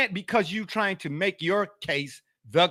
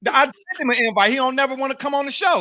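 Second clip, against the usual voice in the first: exasperated complaint; 8 semitones higher, 5 dB louder, faster than usual. Real, not acted.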